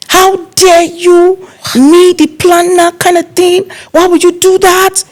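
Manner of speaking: high, slow indignant how dare you; mock outrage. The almighty event planner scolding a guest, mock outrage. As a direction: lord it over them, drawn out and high. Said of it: "I'm feeling like one of almighty event planner".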